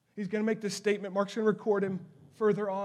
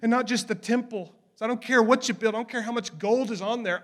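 louder, rising preacher's cry; full-force preaching; 4 semitones higher, 7 dB louder than usual. Acted. Preacher pleading with his listeners, loud and driving. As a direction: preach it hard to the congregation.

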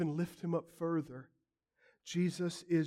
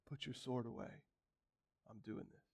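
quiet resolve; 6 semitones lower, 16 dB quieter than usual. Acted. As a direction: drop to a soft, firm hush. Jesus speaking calmly to Peter.

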